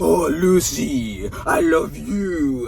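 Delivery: mock French lover. Mock Lucy romantic quote.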